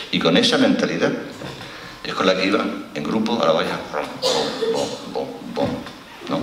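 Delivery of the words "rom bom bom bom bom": slow booming rom bom chant; imitated marching drumbeat. [name] mimics migrants marching on the fence, slow drumbeat chant. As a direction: beat out the march like war drums.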